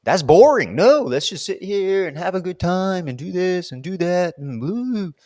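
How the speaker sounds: high, petulant mock-child voice that fades at the end; whiny inner child. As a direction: play your bored, stubborn inner kid. Voices her childish resistant self.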